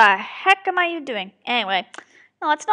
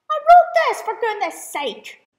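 high-pitched protest at oneself; exasperated outburst; 8 semitones higher, 4 dB louder than usual. Real, not acted. Writer botching own line, exasperated. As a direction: start high, then drag it out in frustration.

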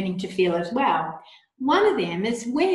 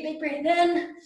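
eager cue; 6 semitones higher, slower than usual. Shown as a demonstration. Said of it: "our tantrum breath".

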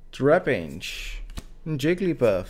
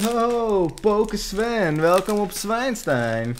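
excited host greeting; 9 semitones higher, much slower than usual. Pretend. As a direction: announce it like a show host. Mock host greeting, Hogwarts pun.

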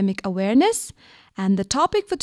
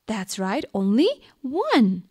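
ordinary delivery

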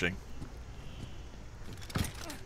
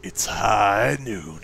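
very slow, lower catchphrase delivery; character impression; 5 semitones lower, much slower than usual. Gamer doing one more character impression, slow low drawl. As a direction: draw each word out low like a game catchphrase. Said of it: "except that one".

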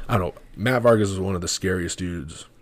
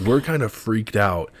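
ordinary talk, nothing marked

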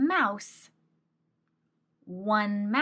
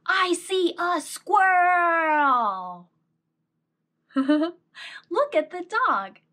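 excited; 8 semitones higher, 6 dB louder than usual. Acted. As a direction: draw it out with excitement. Excited slow reading.

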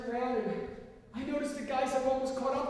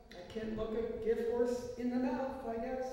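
ordinary delivery

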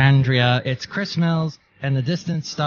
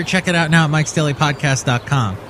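ordinary talk, nothing marked

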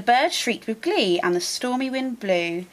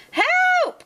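cry for help. Acted. Witch fleeing the dragon, high panicked cry. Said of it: "cried the witch".

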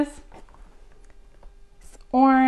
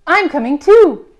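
loud, higher and keen; eager announcement; 6 semitones higher, 9 dB louder than usual. Acted. Raccoon joins Bird's walk, eager loud announcement. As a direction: burst in gladly to join.

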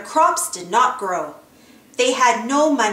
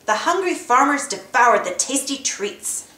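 ordinary delivery

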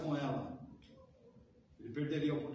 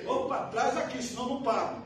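mock demanding customer; 7 semitones higher, 6 dB louder than usual.

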